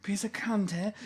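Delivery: high mock Hannibal voice; lightly voiced creepy Lecter. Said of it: "fava beans".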